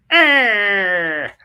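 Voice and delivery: loud, high drawn-out yell; big comic holler; mock scream. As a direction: let out a sudden long "aah". Sudden yell while showing comic cover.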